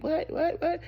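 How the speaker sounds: just woken and can't take it in; confused squeak of disbelief; startled confusion. Retold or imitated. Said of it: "I was half asleep".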